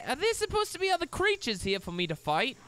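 ordinary talk

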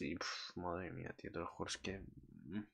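barely breathing it out; awed hush; overwhelmed. Real, not acted. Overwhelmed by the scene.

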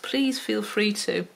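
ordinary talk, nothing marked

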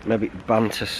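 ordinary talk, nothing marked

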